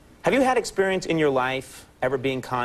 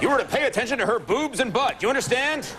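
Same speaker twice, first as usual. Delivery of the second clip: giving orders to a new hire, higher; mock stern boss; 6 semitones higher than usual.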